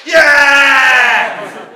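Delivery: long, loud whooping yell; cheering shout. Real, not acted. Host cheers for the battler, long loud shout. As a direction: holler it out with the crowd.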